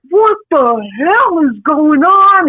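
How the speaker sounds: imitating dad's angry outburst, slow and drawn out. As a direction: play the dad who is flipping out, slow. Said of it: "he was flipping out".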